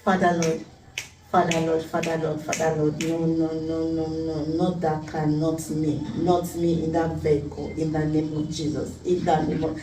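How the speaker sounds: low, soft, hurried-under-the-breath pleading; inward prayer. Retold or imitated. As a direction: pray quietly to yourself in dread of what you see. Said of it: "In my mind, I said".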